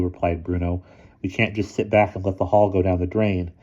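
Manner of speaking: usual speaking voice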